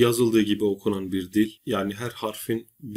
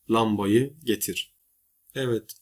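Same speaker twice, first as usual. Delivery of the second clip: ordinary talk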